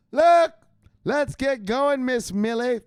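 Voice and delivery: high, loud, slow pitch to the neighbour; selling it with a hyped yell; excited shout. Pretend. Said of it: "Look, Miss Smith".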